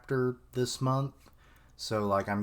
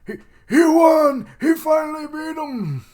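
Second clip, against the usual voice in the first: much higher, louder shouting cheer; ecstatic shout; 11 dB louder than usual. Acted. Sumo master cheering Shiva's win, ecstatic high shout. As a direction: yell it like your fighter just won. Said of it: "He's like".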